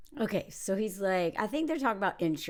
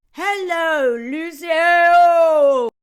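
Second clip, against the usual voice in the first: loud, higher drawn-out mock holler; boisterous greeting; 5 semitones higher, 12 dB louder, much slower than usual. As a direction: holler it like a rowdy flirt. Acting loud guy greeting Lucy.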